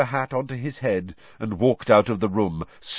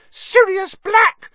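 piercing terrified shriek, much higher and louder, slow; 10 dB louder, slower than usual. Acted. Mrs Weasley shrieking at Sirius in terror. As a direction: scream his name in horror. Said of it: "she shrieked".

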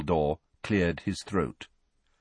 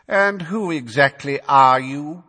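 slow, raised, haughty drawl; bored and lofty; 7 semitones higher, 7 dB louder, slower than usual. A narrator's reading. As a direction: look down on the witness. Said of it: "in a bored and lofty voice".

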